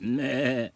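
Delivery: loud imitated bleat back; goat's bleat. Retelling the goat answering, loud bleat. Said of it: "And he said".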